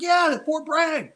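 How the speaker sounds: high, slow, emphatic yeah; retold eager reply; eager confirmation. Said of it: "I was like".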